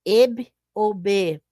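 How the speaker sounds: Eb ou B said slowly as eb then be; teacher modelling eb and be; syllables sounded out slowly. Shown as a demonstration. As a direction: sound out each syllable distinctly. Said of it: "Duas sílabas com B e com E".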